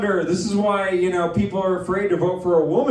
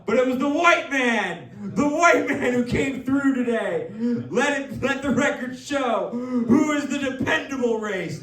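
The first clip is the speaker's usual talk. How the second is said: mock triumph; 7 semitones higher than usual. Mock-triumphant race joke, laughing.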